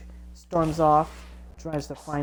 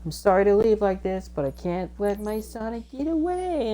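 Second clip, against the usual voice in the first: higher, lightly voiced girl character; Amy; 6 semitones higher than usual. Amy chasing after her Sonic, lightly acted high voice. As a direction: lift the pitch, climbing towards the end. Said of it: "Amy's like".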